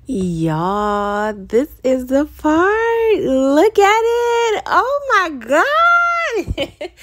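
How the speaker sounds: high, slow, drawn-out squeals of delight; excited squeal. Real, not acted. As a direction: squeal with joy at the result. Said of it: "I yelled in this video".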